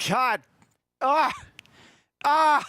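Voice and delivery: high, loud anguished cries; loud, high groans of embarrassment; exasperated cringing outburst. Real, not acted. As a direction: cry out in cringing agony at yourself. Cringing at recording of own voice.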